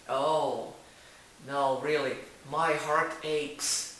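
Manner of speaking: loud, drawn-out English quote; someone lamenting heartache. Acted. Someone confiding in an English learner, acted lament. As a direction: act out heartache, slowing on the last words. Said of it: "quando alguém chegar pra você e disser".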